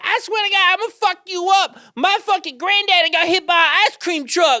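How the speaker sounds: high, loud screechy argument with nobody; hammed-up full-blown screaming tirade; imitated ranting yell. Homeless woman arguing with no one, yelling. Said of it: "yelling at no one".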